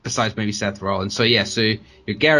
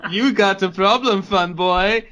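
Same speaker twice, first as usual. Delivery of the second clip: loud, higher hammed-up Irish brogue; Irish accent; 8 semitones higher, 3 dB louder, slower than usual. [name] squaring up to AJ in a loud mock Irish accent. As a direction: give it a big stage-Irish swagger. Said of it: "I can do his accent".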